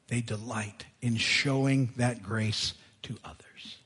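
slow, breathy whisper of the phrase they delight in showing that grace to others. Shown as a demonstration. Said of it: "repeated phrase, ends whispered".

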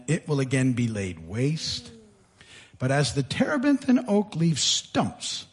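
low, soft, measured reading; hushed, weighty pronouncement; solemn scripture reading. Acted. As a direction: read the grim prophecy quietly and gravely. Reads God's grim answer from scripture.

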